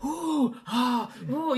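high, drawn-out crowd oohs; wowed spectators' gasping oohs; crowd reaction. Acted. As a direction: ooh and aah like an impressed crowd. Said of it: "you can hear the, the crowd going".

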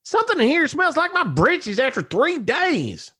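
high falsetto country wife; very high, appalled wife voice; shrieking country wife. Acted. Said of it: "A shriek rang through the house".

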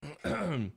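clearing a choked throat. Real, not acted. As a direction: clear your throat hard. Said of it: "Sorry, I'm choking over here".

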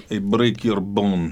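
lightly put-on Russian villain accent, slow; a hint of a menacing movie Russian; mock Russian heavy threatening. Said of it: "you would flex that Russian accent".

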